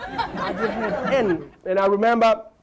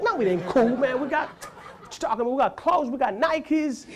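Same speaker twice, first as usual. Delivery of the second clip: protesting self; 7 semitones higher than usual. Retold or imitated. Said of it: "I'm like".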